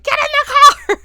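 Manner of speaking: very high, loud imitation of panicked kids; frantic yell. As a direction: act out urgently yelling someone into the car. Voicing family yelling at screaming Diane.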